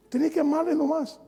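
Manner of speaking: "Tenés que amarle nomás" repetido en tono mucho más alto; repetido más agudo. Shown as a demonstration. (frase repetida más aguda y enfática)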